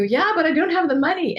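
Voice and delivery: high, loud protesting mind; whiny doubting mind. Retold or imitated. Voicing the mind's protest about money, high and loud. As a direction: whine the objection. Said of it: "easy for the mind to go".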